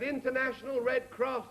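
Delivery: high-pitched hail over distance; calling out. Acted. Search party answering the stranded skier, high carrying call. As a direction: call it up the mountainside.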